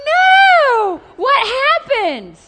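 shocked "No!" hearing a friend's story; shocked outburst. Retold or imitated. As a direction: gasp out the reaction to gossip. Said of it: "I'm like".

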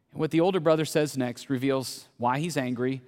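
ordinary talk

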